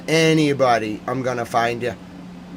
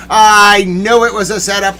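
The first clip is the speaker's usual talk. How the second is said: raised, loud indignant insistence; mad outburst; 6 semitones higher, 5 dB louder than usual. Real, not acted. Ranting to the audience about a setup, mad. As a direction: burst out higher and louder. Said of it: "I'm mad".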